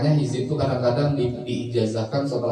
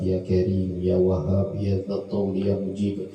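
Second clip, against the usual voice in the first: recited prayer; 4 semitones lower, slower than usual. Acted. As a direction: intone the dua slowly and devoutly. Recites closing supplication.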